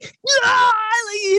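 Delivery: high, full-throated cry; mock battle cry; wild yell. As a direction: throw yourself into the scream. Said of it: "And he's like".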